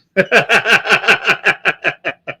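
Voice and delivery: loud high burst of laughter; amused laugh at a viewer. Real, not acted. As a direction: laugh at the commenter. Laughs at the quote.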